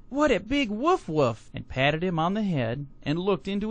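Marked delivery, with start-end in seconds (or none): 0.1-1.5 put-on high, slow, childlike wonder; delighted small child. A narrator's reading. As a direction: marvel like a toddler, drawn out. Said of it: "only laughed and said".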